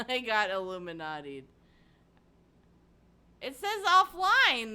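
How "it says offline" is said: high, loud and drawn-out disbelief; baffled outburst. Real, not acted. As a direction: stretch it out in shocked confusion. Frustrated at stream.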